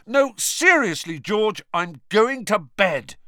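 loud, high, insistent protest; exasperated, slowing for emphasis; fed-up insistence. Acted. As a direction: raise your voice to get away to bed. Fed-up Harry insists on leaving.

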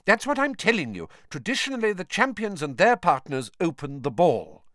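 high, insistent teacher explanation; irritably. A narrator's reading. Professor explaining tradition to a reluctant champion, irritable. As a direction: explain with strained patience, pitched well up. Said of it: "said Professor McGonagall irritably".

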